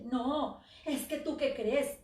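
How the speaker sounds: loud, furious accusation; with great rage. Acted. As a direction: throw the blame in his face. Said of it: "pero con muchísimo coraje".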